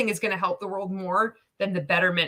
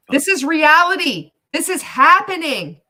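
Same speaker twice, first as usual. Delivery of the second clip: much louder, higher disbelief; stunned excitement; 8 semitones higher, 9 dB louder than usual. Real, not acted. Streamer reacting to the clip, loud and stunned. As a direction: shout it out in shock. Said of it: "Holy shit".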